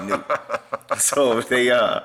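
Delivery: talking through real laughter; laughing. Real, not acted. Man laughing at police catching him return, laughing. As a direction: laugh while you tell it. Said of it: "laughing at the retelling".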